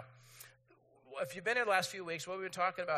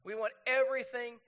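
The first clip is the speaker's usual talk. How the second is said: higher, insistent demand; acted-out impatience, landing hard on "now"; hammed-up want-it-now insistence; 4 semitones higher than usual. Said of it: "We're like".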